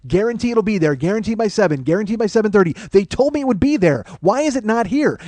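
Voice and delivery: heated high-pitched rant, not screaming; relentless angry phone tirade; heavy-voiced yelling. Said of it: "I'm yelling, all right? I'm, I've got, you know, heavy voice".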